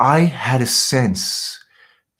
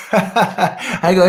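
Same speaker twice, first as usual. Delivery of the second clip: laughing; 8 semitones higher, 3 dB louder than usual. Real, not acted. Laughing while retelling taunt.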